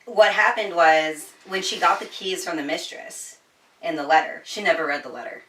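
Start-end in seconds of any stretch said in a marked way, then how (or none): none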